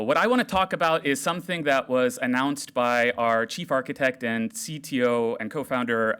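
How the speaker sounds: ordinary talk, nothing marked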